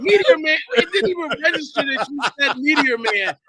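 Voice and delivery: high-pitched incredulous realization; amused disbelief. Real, not acted. Co-host realizes the Meteor Man joke, amused disbelief. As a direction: squeal it out, caught by the joke.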